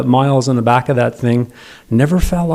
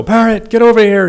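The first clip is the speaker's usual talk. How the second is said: calling out; 8 semitones higher, 3 dB louder than usual. Retold or imitated. Dad calling dog at campsite.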